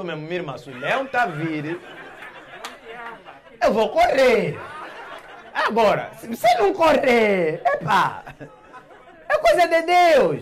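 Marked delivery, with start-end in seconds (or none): 3.6-8.2 emphatic. Acted. Declaring he would flee. 9.3-10.4 mock exclamation.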